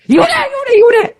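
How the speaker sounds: very high, shrieked repeated demand; mock divorce scream. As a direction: scream it at the top of the range. 부부싸움 절정, 이혼 외침. (부부싸움 중 아내가 남편에게 새된 소리로 외침)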